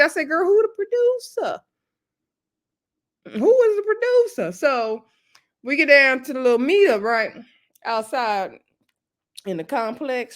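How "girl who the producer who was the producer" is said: high-pitched, eager "who the producer"; excited gossip demand. Retold or imitated. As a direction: leap up high, hungry for the tea.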